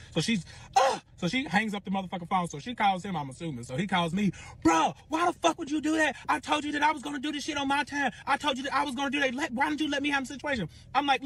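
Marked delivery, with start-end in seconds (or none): 0.8-1.0 high, loud imitated "ugh"; short acted cry of outrage; outraged gasp. Rival's outraged reaction to the picture. 4.6-10.7 high-pitched, exasperated shouting match; high, whiny protest, acted; angry confrontation. Boyfriend's acted angry phone call.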